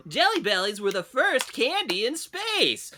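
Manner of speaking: very high chipper host; high kids-show tour guide; cheery tour guide. Pretend. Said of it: "He's like".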